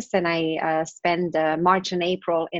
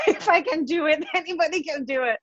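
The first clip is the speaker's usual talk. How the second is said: laughing; 9 semitones higher than usual. Real, not acted. Laughing through her motto.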